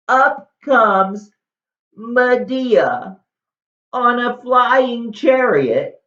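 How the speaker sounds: loud, high, drawn-out fanfare; grand announcement. A narrator's reading. Storyteller announces Medea on flying chariot, loud grand proclamation.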